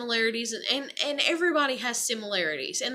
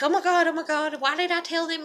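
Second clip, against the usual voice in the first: mild imitation of panic, pitched higher; freaking out; 5 semitones higher than usual. Friend fretting before company comes over, mock freaking out. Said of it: "freaking out before they came over".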